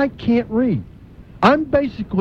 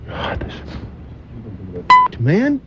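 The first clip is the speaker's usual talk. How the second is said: frustrated groan; 10 semitones lower, much slower than usual. Real, not acted. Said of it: "he's getting angry".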